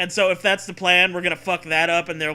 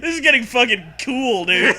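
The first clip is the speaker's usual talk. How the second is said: excited laughing; 6 semitones higher, 6 dB louder than usual. Real, not acted. Excited reaction to story.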